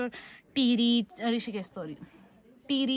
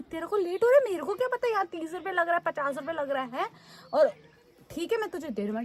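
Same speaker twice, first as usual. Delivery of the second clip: much higher, fast exasperated argument; heated retort; 7 semitones higher, faster than usual. Retold or imitated. Arguing with the conductor over change for thirty rupees, heated. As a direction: throw his words back at him, exasperated.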